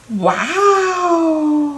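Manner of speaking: high, delighted exclamation of wonder; a gasp of real delight at the card; delighted wow. Real, not acted. Opening a lovely handmade card.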